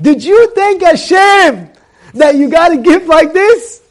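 loud, high, insistent question; emphatic challenge. Retold or imitated. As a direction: press a friend hard with each word. Daughter challenges her friend.

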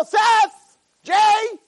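names hollered high and drawn out; acted high-pitched shouting; mock scream. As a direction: yell for friends as if in pain. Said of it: "they're not screaming in their pain".

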